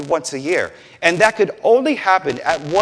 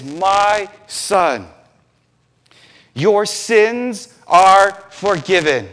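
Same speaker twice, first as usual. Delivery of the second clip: slow, higher and loud, word by word; solemn pronouncement; 4 semitones higher, 4 dB louder, much slower than usual. Retold or imitated. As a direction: pronounce it with weight, drawing out each word. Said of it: "Jesus pronounces that this man's sins".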